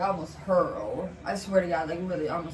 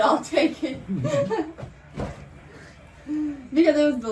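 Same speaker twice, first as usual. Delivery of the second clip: laughing through the line; 7 semitones higher than usual. Retold or imitated. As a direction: crack up on the quote. Laughing while retelling a quote.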